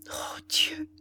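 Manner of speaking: high, aching cry of dismay; anguished cry. A narrator's reading. Ethel crying out as Ordener doubts her love. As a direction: cry out to God in pain. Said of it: "s'écria la pauvre jeune fille".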